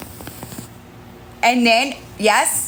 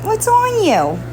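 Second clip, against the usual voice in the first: high cooing question to the kitten; 10 semitones higher than usual. Real, not acted. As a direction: coo it up high to the cat. Repeated in baby talk.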